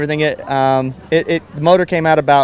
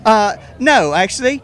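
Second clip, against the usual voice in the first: higher, mock British reply; put-on British accent; 6 semitones higher than usual. Car owner answering in character, put-on British accent. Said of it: "rest of the interview like this".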